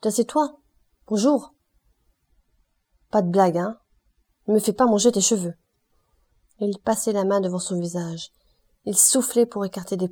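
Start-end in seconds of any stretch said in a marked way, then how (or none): 0.0-5.5 voice rising. A narrator's reading. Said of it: "Il haussait toujours la voix".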